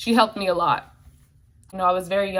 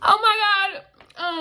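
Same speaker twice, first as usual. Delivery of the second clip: choked up; 10 semitones higher, slower than usual. Real, not acted. Overwhelmed, starting to cry.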